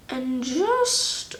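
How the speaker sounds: ordinary talk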